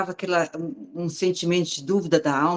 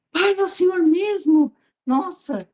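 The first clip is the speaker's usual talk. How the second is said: high-pitched, awestruck question to a father; amazed disbelief; 13 semitones higher than usual. Retold or imitated. Woman asking God if it is really Him, amazed. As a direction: re-enact stunned wonder, voice leaping up high. Retold awe at hearing God's voice.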